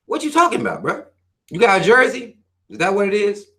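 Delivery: incredulous mock questioning, pitch jumping high; mocking disbelief. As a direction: scoff at the fan like he's joking. Mocking a sports fan.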